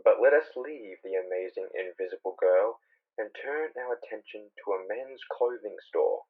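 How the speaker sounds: normal talking voice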